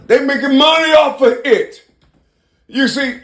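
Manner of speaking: high, loud and fast, all worked up; fired-up rant. Acted. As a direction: preach it hot, voice climbing high. Rant builds about slapping people.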